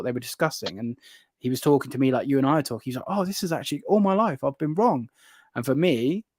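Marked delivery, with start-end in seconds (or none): 3.1-5.1 higher-pitched retold revelation; surprised realization. Converted visitor admitting error to keeper, amazed. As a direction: voice a mild awakening, starting high. Retells man's revelation about lizards.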